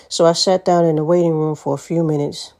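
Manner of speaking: normal talking voice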